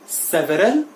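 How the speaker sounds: सेवरल in three syllables, se-ve-ral, rising; wrong pronunciation. Shown as a demonstration. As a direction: sound the middle e in सेवरल. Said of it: "it is wrong". (सेवरल का गलत उच्चारण, से-व-रल)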